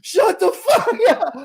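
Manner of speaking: very high, loud, drawn-out scream; hammed-up hysterical shriek; losing it. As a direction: scream it like you've lost your mind. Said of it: "Just me losing my fucking mind".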